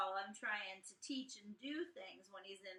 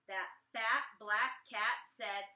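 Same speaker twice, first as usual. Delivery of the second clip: ordinary delivery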